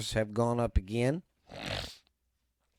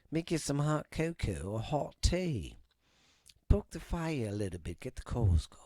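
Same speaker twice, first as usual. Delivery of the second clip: hushed spooky invitation; 5 dB quieter than usual. Acted. Cozy spooky podcast setup.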